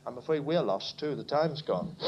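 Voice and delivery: usual speaking voice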